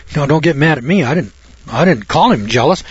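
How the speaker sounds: ordinary delivery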